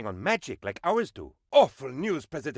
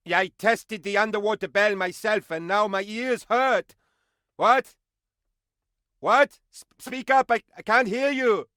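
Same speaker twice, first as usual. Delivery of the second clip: loud, high, half-deaf shouting; 8 semitones higher, 4 dB louder than usual. Acted. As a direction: yell because your ears ring. Deafened by the bell, shouting.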